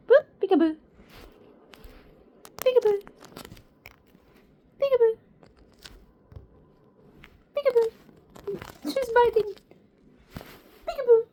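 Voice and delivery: high, loud sing-song peekaboo; playful peekaboo. Pretend. Owner playing peekaboo with bird, high and loud. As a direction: call it out like a game.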